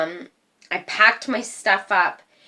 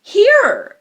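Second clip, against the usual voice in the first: high, loud thrust of a word; shouting it as she hands the keys back; defiant here; 13 semitones higher, 9 dB louder than usual. Retold or imitated. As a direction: shove it at her, loud and high.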